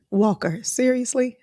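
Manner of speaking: high, tearful seriously; touched disbelief. Real, not acted. Mother reacting to her son's comment, high and tearful. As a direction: caught off guard by his sweetness.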